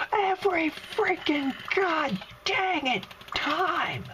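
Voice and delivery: slow, high-pitched furious wail; exasperated outburst. Real, not acted. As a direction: hammer out each word in high exasperation. Startled by repeated monster ambush.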